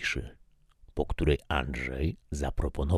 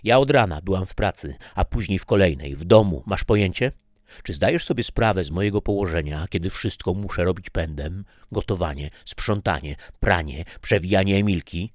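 fast, louder, indignant listing; frustrated rant; 3 semitones higher, 4 dB louder than usual. Acted. Wife ranting to husband about her workload, fast indignant. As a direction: pour out the grievances in a rush.

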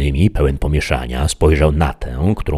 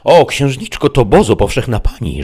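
Sancho; 8 semitones higher, 4 dB louder than usual. A narrator's reading. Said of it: "rzecze Sancho".

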